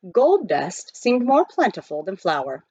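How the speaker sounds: usual speaking voice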